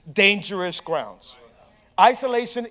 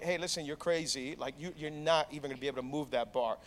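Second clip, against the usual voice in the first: low, much softer retold caution; talking him down; 6 semitones lower, 14 dB quieter than usual. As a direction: quietly reason with an overconfident friend. Retelling friends talking him down.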